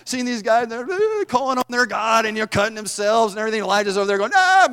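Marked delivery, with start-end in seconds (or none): 4.3-4.7 high, loud taunting "Ah"; mocking "ah". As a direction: jeer at the false prophets. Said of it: "making fun of them".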